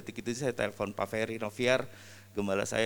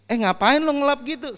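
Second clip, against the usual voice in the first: high, loud scornful jeer; mock him like a haughty guest; mocking pastor; 13 semitones higher, 8 dB louder than usual. Pastor mocking the cameraman wiping the table, high scornful jeer.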